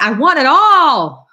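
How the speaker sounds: loud, drawn-out mock demand; playfully greedy. Reader joking about her own impatience, playfully greedy. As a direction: stretch it out high and loud, hamming up impatience.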